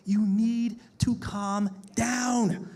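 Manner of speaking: slow, emphatic warning; slow it right down; slow and firm. Real, not acted. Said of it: "But look".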